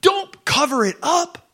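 high, slow shout of Jesus urging. Retold or imitated. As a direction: cry it out as Jesus pleading. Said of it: "He's like".

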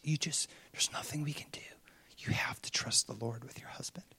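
hushed, whispery comfort. Retold or imitated. Believer to panicking friend on the wagon, comforting whisper. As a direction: whisper gently to calm her down. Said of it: "is just comforting her".

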